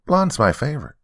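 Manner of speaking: put-on high, eager child voice; a small girl sharing her favourite; little girl voice.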